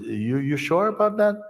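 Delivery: unsure, a little scared; nervous learner driver doubting. Retold or imitated. Nervous learner questions instructor.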